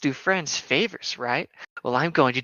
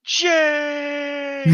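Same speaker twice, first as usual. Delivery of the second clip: shouting name; 9 semitones higher than usual. Acted. Calling Jane loudly.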